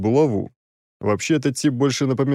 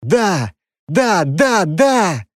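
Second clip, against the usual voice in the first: ecstatic, rising, louder yeses; blissful yes; 8 semitones higher, 5 dB louder, much slower than usual. Acted. Prince hears she loves him, ecstatic shout. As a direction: burst out with blissful joy. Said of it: "Блаженно оскалился он".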